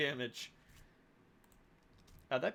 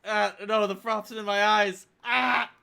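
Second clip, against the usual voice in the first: panicked character; 2 semitones higher, 7 dB louder than usual. Acted. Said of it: "And you're like".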